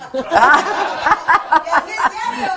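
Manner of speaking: long, high, loud laugh. Real, not acted. Host reacting to guest's praise of show, loud laughter.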